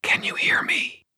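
breathy whisper. A narrator's reading. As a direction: whisper it gently. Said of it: "I whispered to her".

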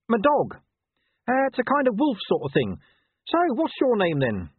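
high, loud, over-friendly dog; insanely cheerful. A narrator's reading. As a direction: chatter on with forced cheer. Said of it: "with insane cheerfulness".

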